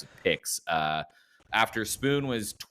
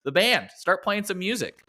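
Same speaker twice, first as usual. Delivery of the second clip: excited call; 10 semitones higher, 5 dB louder than usual. Real, not acted. Host calls for band intro.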